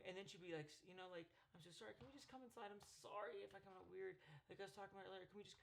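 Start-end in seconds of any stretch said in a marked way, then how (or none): 1.5-5.6 soft, higher, run-on imitated apology; glitching apology. Girlfriend apologizing for coming out weird, imitated glitching. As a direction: plead softly in a glitchy, unfinished ramble. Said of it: "She's, like, glitching".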